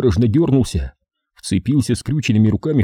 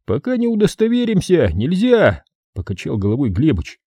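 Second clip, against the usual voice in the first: raised, firm objection; firm refusal; 8 semitones higher than usual. A narrator's reading. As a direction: shut it down firmly. Глебыч возражает. (твёрдый отказ)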